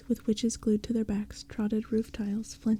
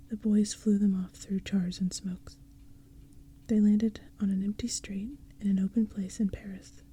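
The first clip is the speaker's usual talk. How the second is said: usual speaking voice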